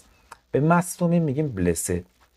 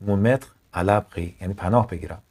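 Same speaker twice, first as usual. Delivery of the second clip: ordinary delivery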